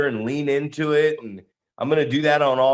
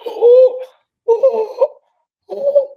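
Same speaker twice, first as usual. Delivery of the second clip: very high, squeaky wheezed "oh"s; imitated winded moaning; 6 dB louder, much slower than usual. Imitating a trainee hit in the gut, high winded moans. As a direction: mimic gasping with the wind knocked out. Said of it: "he went down, and he's going".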